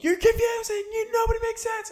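high shrill-pitched mocking scream. Recapping Sai screaming at Jessel on the boat, mock scream. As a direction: screech it way up high like an angry castmate. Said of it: "Sai starts screaming at Jessel".

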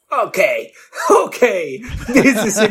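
laughing through the words. Real, not acted. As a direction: giggle while speaking. Laughing at memory.

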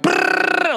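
high, breathy revving-engine sound; car engine noise. Retold or imitated. Telling how a drunk friend drove off, mock engine rev. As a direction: imitate a car tearing down the steps. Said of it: "just went, like".

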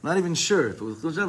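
normal talking voice

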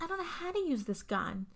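high, helpless-girl imitation; hammed-up naive protest; mock innocent. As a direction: play the innocent one, pitched well up. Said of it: "she's, like, the innocent one".

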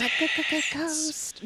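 high pretend ghost voice with a stutter; mock stuttering ghost. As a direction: play a spooky ghost stuck on the G. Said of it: "what is the voice saying".

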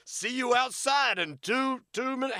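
high, loud, slow challenge; hammed-up high call to step outside; mock challenger. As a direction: announce the fight time with bravado.

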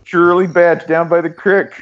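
high-pitched mock Pittsburgh accent. Host joining the joke about Pittsburghers, high mock Pittsburgh accent. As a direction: pitch it up as a comic Pittsburgh local. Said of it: "the Pittsburgh accent".

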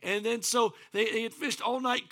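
ordinary talk, nothing marked

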